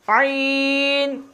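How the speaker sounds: आइन drawn out very slowly from mid throat; stretched ain. Shown as a demonstration. Teacher demonstrating आइन, very slow and drawn out. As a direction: hold the आइन long and throaty. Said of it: "पहले सबसे आइन को".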